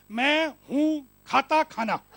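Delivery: loud, very slow, word by word; slow demonstration. Shown as a demonstration. Speaker showing English word order to listeners, slow emphatic word-by-word delivery. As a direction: punch out each word separately with clear gaps.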